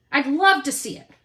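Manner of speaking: usual speaking voice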